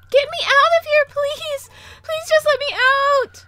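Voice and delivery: desperate screaming. Acted. June pounding on door.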